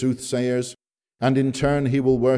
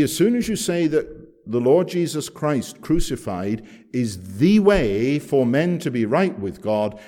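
ordinary talk, nothing marked

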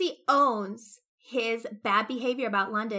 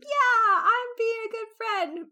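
much higher, louder mock-innocent voice; smug Darcy; 13 semitones higher, 9 dB louder than usual. Darcy insisting to his friend he did right, mock-smug and high. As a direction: play him as pleased with himself, pitched up high. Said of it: "Darcy is like".

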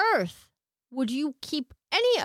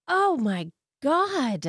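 shocked; 5 semitones higher, much slower than usual. Real, not acted. Shocked reaction to case detail.

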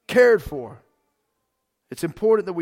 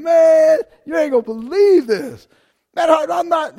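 loud, higher, excited friend blurting out news; hyped buddy shouting that you won't believe it; impression of Daniel; 7 semitones higher, 6 dB louder than usual. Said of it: "did I do a pretty good impression of Daniel?".

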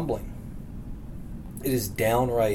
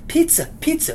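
calling out instructions. Retold or imitated. Everyone on the mountain calling.

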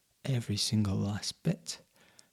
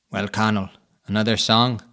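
loud, booming cat voice; big cat voice; 11 dB louder than usual. A narrator's reading. Big cat voiced in told story.

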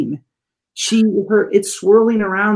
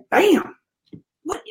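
mock amazed bam; 11 semitones higher, 3 dB quieter than usual. Kid's stunned reaction imitated.

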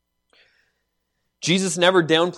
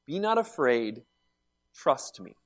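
softer "Be not afraid, trust me", like a quiet promise; tender reassurance; 6 dB quieter than usual. Retold or imitated. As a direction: say it softly, gently, to calm him. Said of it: "In other words".

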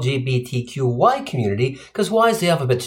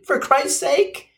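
exasperated; 9 semitones higher, slower than usual. Acted. Exasperated at phrase having a day.

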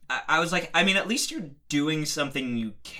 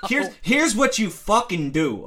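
slow, loud and insistent; loud slow emphasis; 6 semitones higher, 4 dB louder than usual. Real, not acted. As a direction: spell it out forcefully, high. Heated advice, then repeated.

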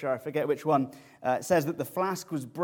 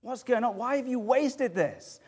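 indignant outburst; 4 semitones higher than usual. Retold or imitated. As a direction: voice the disciples' outrage. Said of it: "They're infuriated".

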